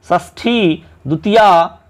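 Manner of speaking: normal talking voice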